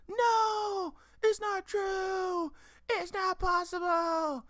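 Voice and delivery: very high, slow drawn-out anguished cry; high, stretched-out despairing no; Luke's wail. Retold or imitated. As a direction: wail the denial. Luke's famous denial.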